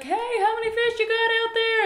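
high, loud call across the water; yelling. Retold or imitated. Man on shore calls to fishermen in their boat, yelling. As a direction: holler out to people far off. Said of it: "the man yells at them".

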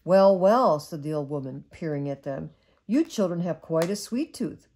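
gentle storybook read-aloud; cosy bedtime story narration; reading to children. Acted. Mother reads bedtime story.

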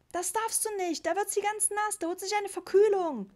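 put-on high, loud child's wail; protesting boy. A narrator's reading. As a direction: plead loudly for your pet. Boy protests against putting cat out. (laut protestierender Junge, hohe Stimme)

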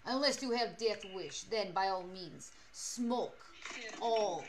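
put-on deliberate Russian-accented speech, words spaced out. Streamer warns chatter about smoking cigarettes, mock Russian accent. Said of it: "Well, in Mother Russia".

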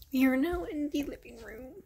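ordinary talk, nothing marked

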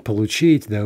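ordinary talk

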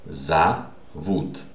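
"Зовут" in two separate syllables, "za... VUT"; O pronounced A. Shown as a demonstration. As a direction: sound out "Зовут" with "za" first, then a pause. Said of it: "This is pronounced А".

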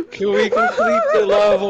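high, fast laughing plea; begging through laughter. Real, not acted. Laughing fit.

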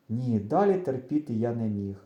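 ordinary delivery

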